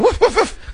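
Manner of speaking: very high-pitched little woofs; mock dog bark. Host plays a dog being called in, high mock barking. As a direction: yip like a small dog.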